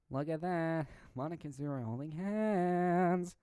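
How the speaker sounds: loud, high sing-song taunt; drawn-out playground-style jeer; teasing. Acted. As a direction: tease them, dragging out the last word. Acted teasing of a couple.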